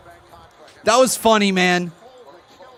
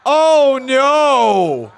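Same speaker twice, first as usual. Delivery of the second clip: shocked cry; 3 semitones higher, 5 dB louder than usual. Real, not acted. Reacting to surprise entrance.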